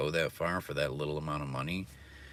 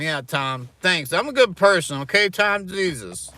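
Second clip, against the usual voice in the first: high, loud mocking impression of a self-righteous girl; loud, pitched-up parody of a girl who thinks she's good; mocking girly voice; 10 semitones higher, 9 dB louder than usual. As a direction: ham up a smug girl. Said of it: "my impression of a girl who thinks she's a good person".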